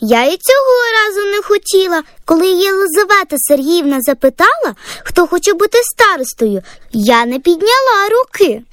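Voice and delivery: put-on proud high girlish chatter; brag, puffed up with pride; bursting with pride. Girl tells mom how the teacher praised her, bursting with pride. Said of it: "Дівчинку просто розпирало від гордості".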